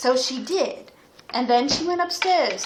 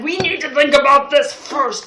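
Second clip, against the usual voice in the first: grumpy objection; 7 dB louder than usual. Acted.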